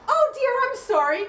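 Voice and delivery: high-pitched imitation of a stranger; mock a fussy apology up high; apologetic intruder. Acting the intruding woman.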